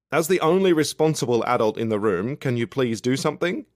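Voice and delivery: loud, high appeal for help; calling out. A narrator's reading. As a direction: call across the room, exasperated. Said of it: "I call out to him".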